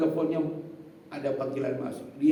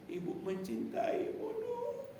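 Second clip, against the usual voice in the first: faint dying words; 7 dB quieter, slower than usual. Retold or imitated. Dying mother's last words.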